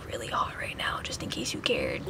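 quiet sarcastic aside; whispered aside. Real, not acted. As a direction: drop to a whisper. Aside to viewers.

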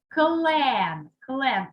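клен with a long, firm «е»; stressed check word. Shown as a demonstration. Teacher gives the check word, клен with drawn-out «е». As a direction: hold the vowel in клен. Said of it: "Добираємо перевірне слово".

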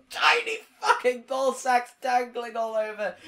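high, loud wailing swearing; anguished cursing. Real, not acted. Gamer dying at the puzzle, anguished cursing. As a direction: wail out absurd curses in frustration.

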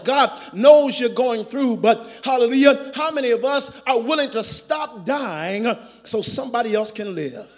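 usual speaking voice

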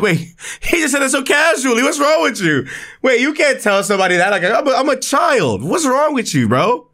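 high-pitched, incredulous outburst; shocked disbelief. Real, not acted. Player stunned by the professor's casual arm threat, high incredulous outburst.

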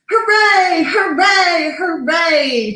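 high storybook crowd cheer, easing down. A narrator's reading. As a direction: start high and loud, step down each time. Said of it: "cheered the crowd".

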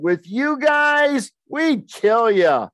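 slow, high mock-tough taunt; a high, slow schoolyard threat; menacing threat. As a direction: drag it out like a smug bully.

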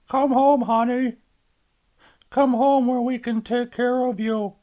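a mother pleading with her daughter; mother's voice. Acted.

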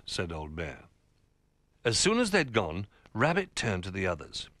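usual speaking voice

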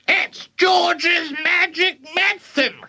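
very high, slow screech; drawn-out high granny shout. A narrator's reading. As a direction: screech it out as the old woman. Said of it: "Grandma shouted".

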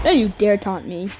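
ordinary talk, nothing marked

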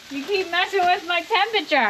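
high, teasing complaint; playful scolding. Real, not acted. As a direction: scold him lightly, half laughing. Mock scolding over grill temperature.

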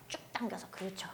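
soft breathy whisper; whispered cue. Real, not acted. Trainer cues quietly during stretch.

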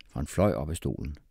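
ordinary delivery

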